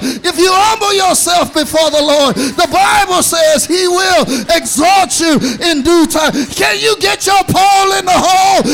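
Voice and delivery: loud, rising sermon climax; preaching at full force. Acted. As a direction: drive it home to the congregation, shouting.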